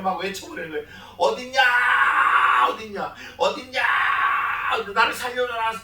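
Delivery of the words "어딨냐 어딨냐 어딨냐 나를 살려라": acted wailing shout. Abandoned one crying alone on the mountain, acted wailing shout. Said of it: "통곡해버리는 거예요".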